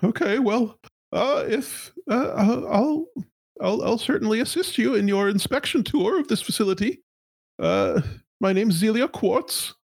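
high, nervous corporate stammer; a flustered high-pitched executive. Acted. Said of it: "And she says".

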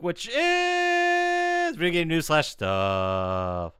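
slow drawn-out segment intro; announcer voice. Acted. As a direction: announce it like a show host.

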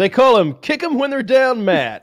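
booming ring announcer intro; mock fight announcer. Announcer-style intro of nickname.

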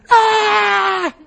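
high, loud mock scream of a frightened child; child's scream. Preacher imitates the trapped boy screaming, high and loud. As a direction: let out a child's panicked scream. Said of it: "he starts screaming".

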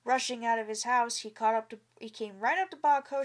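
ordinary delivery

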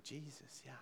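hushed, private murmur to Jesus; quiet prayer. Retold or imitated. Preacher recalls talking to Jesus in bed at night, quiet prayer. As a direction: say it very softly, as if alone in a quiet moment. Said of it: "just a moment of quiet".

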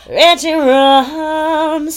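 playful drawn-out echo. Pretend. Echoing the playful nickname.

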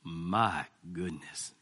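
deep, very slow, soft Southern drawl; a low, slow Southern Baptist my goodness. Retold or imitated. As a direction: drag it out low like a Southern preacher. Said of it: "big Southern, Southern Baptist voice".

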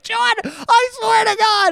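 very high, slow mock shriek; hammed-up high-pitched fury; imitated angry wife. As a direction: squeal the threat like an exasperated wife. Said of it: "things she was saying to me".

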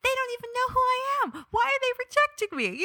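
high, fast wail of imitated rejection; hurt inner voice. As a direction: replay the hurt self-pity in a high rush. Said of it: "Now I'm feeling like".

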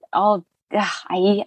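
usual speaking voice

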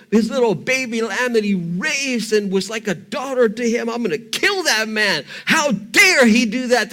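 loud outraged rant; burning anger. Acted. David demands vengeance on the rich man, raging loudly. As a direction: rage at the injustice, full voice. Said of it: "He's burning with anger".

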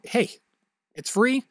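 normal talking voice

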